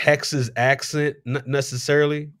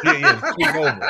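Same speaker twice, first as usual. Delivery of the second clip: laughing along; 4 semitones higher, 4 dB louder than usual. Real, not acted. Laughing at own slip.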